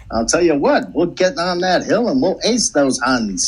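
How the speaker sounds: high, upbeat 1940s newsreel; rally it like a wartime reel; old-timey voice. Acted. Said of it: "Old-timey voice".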